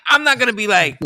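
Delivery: usual speaking voice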